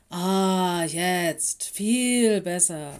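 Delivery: loud, drawn-out sigh of satisfaction; relieved. A narrator's reading. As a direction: savour the relief slowly. Hearing aids just put in.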